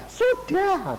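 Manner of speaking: high squeaky "Sudah"; a sleepless caller answering the preacher in a high mocking voice; mock smug reply.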